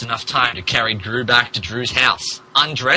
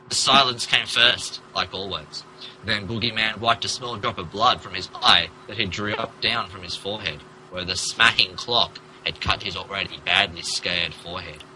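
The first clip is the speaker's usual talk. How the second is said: much softer, low storytelling hush; hushed narration; 7 semitones lower, 8 dB quieter than usual. A narrator's reading. Narrator hushes as time freezes after the clock. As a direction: draw them in with a hush.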